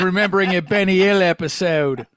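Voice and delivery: put-on Cockney protest; fake British accent. Fake Biff denies chuckling at the joke, hammed-up British accent. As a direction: play a mock Londoner. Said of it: "I don't know what accent I'm doing now".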